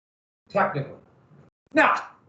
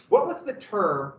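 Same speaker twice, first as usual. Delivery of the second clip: normal talking voice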